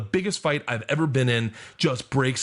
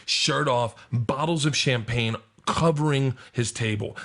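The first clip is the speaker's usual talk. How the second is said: ordinary talk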